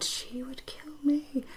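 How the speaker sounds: soft unvoiced joking aside; whispered self-mocking prediction; playful whisper. Real, not acted. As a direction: whisper it like a secret joke. Joking aside about the match.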